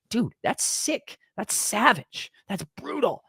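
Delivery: high, slow, stunned exclaim; awed exclaim. Real, not acted. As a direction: marvel at how savage it is. Awed reaction to brutal punishment.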